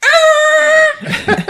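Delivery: very high, loud mock scream; enraged scream. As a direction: scream as if about to attack. Enraged driver in retold scene.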